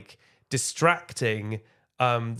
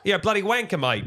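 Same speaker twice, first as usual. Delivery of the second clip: higher-pitched, hammed-up Australian accent; mock Australian accent; 7 semitones higher than usual. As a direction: play a jokey Aussie accent. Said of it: "Do Australian people say wanker?".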